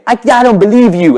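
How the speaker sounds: sudden high, loud yell. Retold or imitated. Singer to a hushed crowd, sudden shout. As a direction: imitate a singer blasting back at hecklers. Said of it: "Then he went loud".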